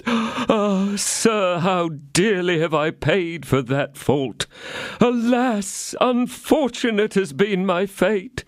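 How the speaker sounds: high, slow, wailing innkeeper; lamentable tone. A narrator's reading. Innkeeper begging D'Artagnan to drop it, lamenting slowly. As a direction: plead in misery, drawn out. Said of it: "in a most lamentable tone of voice".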